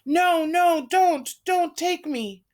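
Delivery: high, loud nightmare screaming; terrified scream. Acted. Girl in a nightmare screaming to be spared. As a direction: scream for your life in a nightmare. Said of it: "Anne screaming".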